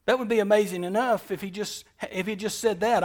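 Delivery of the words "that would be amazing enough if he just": ordinary talk, nothing marked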